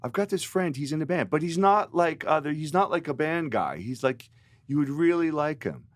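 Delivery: lightly voiced pushy friend selling a match; mock-earnest matchmaker; imitating Patrick. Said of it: "he was saying to his friend".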